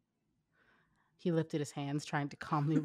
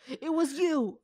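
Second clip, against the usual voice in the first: accusing shout; 9 semitones higher, 5 dB louder, slower than usual. Acted. Naruto grabs Shikamaru accusing.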